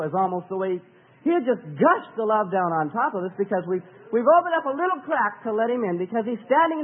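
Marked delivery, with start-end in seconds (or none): none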